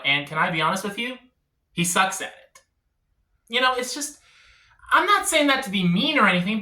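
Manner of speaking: ordinary delivery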